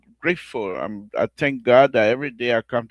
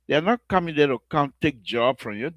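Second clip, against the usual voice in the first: ordinary talk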